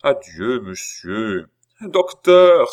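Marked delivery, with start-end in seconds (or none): 1.8-2.7 much higher, much louder anguished call; despairing shout of a name; desperate cry. A narrator's reading. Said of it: "s'écria Philippe au désespoir".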